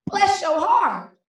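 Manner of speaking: high, slow Southern drawl; down-south mama. Acted. Poet quotes mama's veiled insult, drawn-out Southern sweetness. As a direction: stretch it out Southern-style, falling on the last word. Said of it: "so down south, she said".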